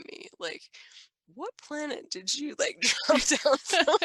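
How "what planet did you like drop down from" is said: high-pitched mock disbelief breaking into laughter; incredulous retold question, laughing. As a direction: imitate a baffled onlooker, laughing through it. Imitating a baffled resident, laughing.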